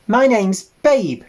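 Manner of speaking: put-on high, loud and cheerful young piglet; jolly voice. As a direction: bounce it out brightly like a friendly piglet. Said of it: "he said in a jolly voice".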